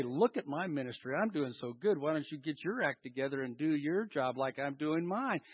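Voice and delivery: low, soft self-satisfied aside; mock boasting in an undertone; smug boast. Mimicking a minister proud of his own work, smug.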